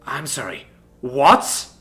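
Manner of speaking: sudden loud what; shocked. Acted. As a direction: disbelief snapping upward. Shocked at the poison.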